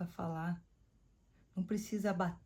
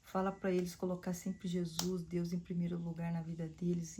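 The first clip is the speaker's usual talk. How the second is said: ordinary talk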